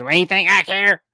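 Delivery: put-on Alabama fan in a thick Southern accent; Harvey Updike Junior Southern accent. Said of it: "reenact the Harvey Updike Junior call".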